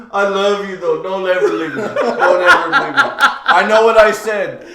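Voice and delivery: loud, high, hammed-up drunk wailing; mock drunken crying. Drunk friend on the steps begging not to be left, crying. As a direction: imitate a weepy drunk pleading, building to a high wail. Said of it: "like, sitting there on the steps crying".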